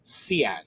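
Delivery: Cian said as see-AN, Spanish way; Cian, the way the driver said my name; Spanish-style [name]. Retold or imitated. Driver greeting him, [name] said the Spanish way. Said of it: "he even said".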